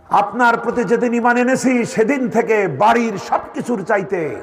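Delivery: high-pitched impassioned preacher's quote; fervent declaration. Retold or imitated. Preacher voicing Abu Bakr declaring love for the Prophet, fervent. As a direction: proclaim the acted devotion with soaring pitch.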